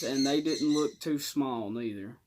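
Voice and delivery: usual speaking voice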